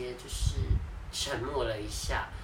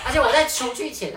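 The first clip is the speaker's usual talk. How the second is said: ordinary talk, nothing marked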